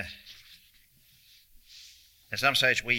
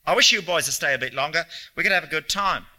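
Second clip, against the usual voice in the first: pleading; 6 semitones higher, 4 dB louder than usual. Acted. Lonely man pleads with guests.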